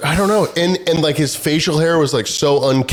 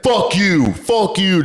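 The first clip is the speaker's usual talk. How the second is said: higher-pitched mock cursing, falling at each end; retold angry cursing; 7 semitones higher, slower than usual. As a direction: imitate a passenger lashing out. Passenger curses at the stewardess.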